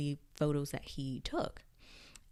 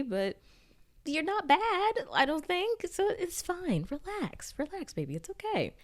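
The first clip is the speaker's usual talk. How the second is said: much higher, coddling comfort voice; high, teasing reassurance to Louis; mock soothing; 9 semitones higher than usual. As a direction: coo at him like calming a fussy child. Speaker comforts character, mock soothing.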